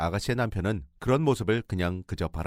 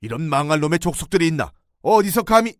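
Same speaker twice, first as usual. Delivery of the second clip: father-in-law's roar, much higher and louder; enraged shout; 7 semitones higher, 8 dB louder than usual. Retold or imitated. Father-in-law hears the story and roars. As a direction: explode in outrage. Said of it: "흥분한 채로 소리를 질렀어요".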